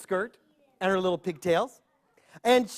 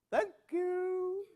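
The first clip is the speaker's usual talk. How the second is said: put-on old man; 10 semitones higher, 10 dB quieter than usual. Grandpa thanks storekeeper.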